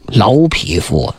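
contemptuous insult. Acted. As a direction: drag it out with royal contempt. King insults kneeling lord.

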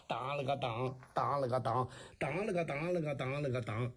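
vocalised rhythmic clapper beat, low; clapper-beat imitation. Shown as a demonstration. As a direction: chant the kuaishu clapper rhythm by mouth. Mouths kuaishu clapper beat. (嘴里打山东快书板点)

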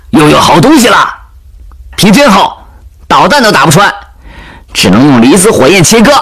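high-pitched worksite chatter, called out; busy soldiers calling out. Acted. Soldiers cutting up the wreck together, high excited calls. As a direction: call across to your workmates in a high voice.

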